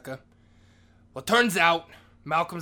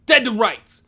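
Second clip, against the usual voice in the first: loud, higher, hammering it home; shouted insistence; 6 semitones higher, 12 dB louder than usual. Retold or imitated. Janitor shouting his proof at the vice principal. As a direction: yell it like the case is closed.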